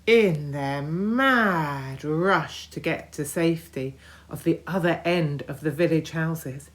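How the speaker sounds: plain reading voice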